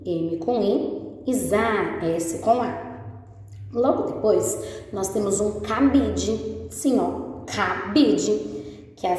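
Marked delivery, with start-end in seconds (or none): none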